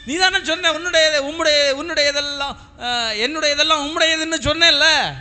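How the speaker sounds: high, loud, insistent reproach; acted rebuke. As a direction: throw their promise back at them, loudly. Acted God confronting the weeping man.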